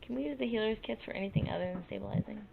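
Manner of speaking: very soft, under the breath; hushed, barely projected aside; near whisper. Real, not acted. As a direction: say it quietly, as if off to yourself. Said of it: "You're whispering again".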